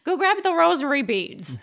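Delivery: put-on loud, high old grandma command; stern grandma. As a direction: order it like a strict grandma. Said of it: "a grandma ... being like".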